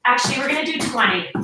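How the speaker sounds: ordinary delivery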